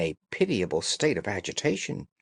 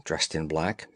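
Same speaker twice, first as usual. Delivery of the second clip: plain reading voice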